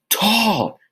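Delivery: high, loud shouted word; demonstrated scream. Shown as a demonstration. Teacher acts out the capital T drowning out the little one, screaming. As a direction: yell it out high, letting it fall away. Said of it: "that's like me screaming".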